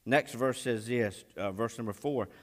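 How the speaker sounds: normal talking voice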